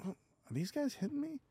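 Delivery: put-on high, soft drunken puzzlement; acted tipsy bewilderment. Drunk man realising he is being punched, high soft bewilderment. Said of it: "kinda drunk, so I'm like".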